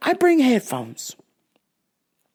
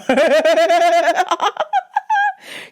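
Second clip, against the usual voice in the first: loud, high burst of laughter; a loud burst of disbelieving laughter; 9 semitones higher, 3 dB louder than usual. Real, not acted. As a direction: crack up at the clip. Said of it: "You just look stupid".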